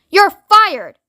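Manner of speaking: high, loud dismissal; angry shout. A narrator's reading. Ground control sacking Shuckworth, angry shout. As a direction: shout it as a furious verdict. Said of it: "shouted ground control".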